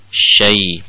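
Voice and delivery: ordinary talk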